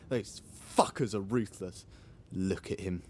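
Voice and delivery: low, hushed contempt; suspicious muttering. Acted. Resident spying on the man outside, low contemptuous mutter. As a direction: mutter darkly while spying. Spying on feared licence inspector.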